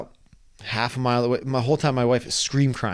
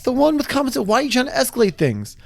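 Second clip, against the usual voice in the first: inner exasperation; 11 semitones higher than usual. Retold or imitated. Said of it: "in my head".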